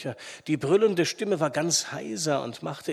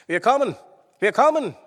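high, loud shout of reassurance; calling out at full voice; 7 semitones higher, 10 dB louder than usual. A narrator's reading. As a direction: holler ahead to someone trapped. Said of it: "rief er laut".

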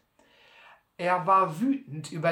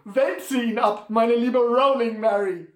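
calling out a command; 5 semitones higher, 8 dB louder than usual. A narrator's reading. Sam orders his mule to roll, calling out loudly. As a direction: sing out the order to the animal. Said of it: "sondern rief".

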